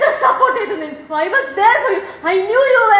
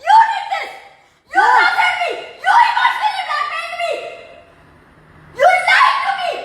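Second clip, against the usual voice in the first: furious shout; 10 semitones higher, much slower than usual. Real, not acted. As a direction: yell every accusation with a broken laugh. Daughter screaming at her father.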